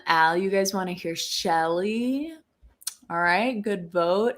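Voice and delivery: ordinary delivery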